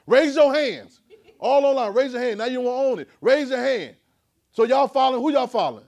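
high, loud, pushing the crowd to answer; loud preaching call. Real, not acted. Preacher calling the congregation to raise hands, loud and high. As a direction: call out to the room and demand hands.

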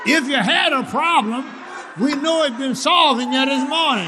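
high preacher's proclamation; fervent preaching. Real, not acted. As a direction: declare it to the whole church. Preaches in raised voice.

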